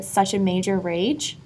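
usual speaking voice

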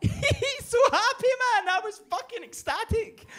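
high, slow giddy rush; soaring with joy; squealing happiness; ecstatic. Retold or imitated. Recalling ecstatic moment.

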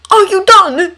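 high, loud impatient call; urgent shout. Acted. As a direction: hurry him with a shout. Obi-Wan urges under attack.

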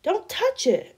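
high, loud panicked scream; frightened scream. A narrator's reading. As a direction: scream to stop him touching it. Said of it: "I screamed".

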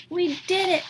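high, thrilled exclamation; excited cry. Acted. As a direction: burst out with triumph. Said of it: "cried Toad".

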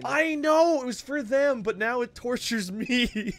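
mock anguish. Streamer bemoans his own donation alert, mock wail. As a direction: whine it high, stretching the ending.

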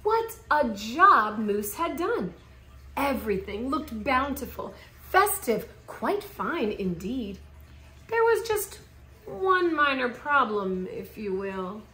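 ordinary delivery, nothing marked

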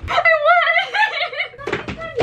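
shrieking with joy; excited shout. Real, not acted. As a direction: scream it out in disbelief. Just won fan sign lottery.

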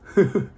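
short loud laugh that drops; loud burst of laughter. Real, not acted. Laughing at own drinking.

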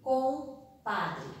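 "compadre" broken as com, pause, padre; slow dictation. Shown as a demonstration. Teacher dictates word while writing. ("compadre" ditado em partes, com-padre)

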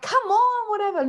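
mock angry outburst. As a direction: snap it like losing your temper at a cop. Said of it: "I start getting angry".

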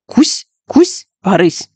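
chomp chomp like gnawing a pencil; chewing sounds. A narrator's reading. Judy nervously chewing her pencil, playful munch.

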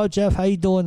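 bright, high friendly greeting from the deli man; a retold greeting. Old deli man greets young Jeff, high and cheery. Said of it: "he'd go".

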